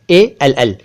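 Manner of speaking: normal talking voice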